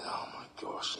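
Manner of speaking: breathy whisper to himself; whispered thought. Retold or imitated. Grieving friend whispering his thoughts, hushed whisper. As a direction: whisper it in disbelief.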